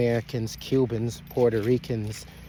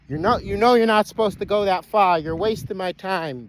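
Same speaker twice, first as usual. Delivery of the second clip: high-pitched, raised-voice telling-off; real annoyed rebuke; scolding; 8 semitones higher, 3 dB louder than usual. Real, not acted. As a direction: scold the runaway in exasperation. Scolding runaway Jake.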